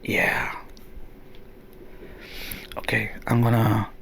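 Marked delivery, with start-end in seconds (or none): 2.8-3.9 whisper. Real, not acted. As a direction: whisper so as not to break the mood. Fiddling in the dark.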